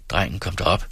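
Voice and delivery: plain reading voice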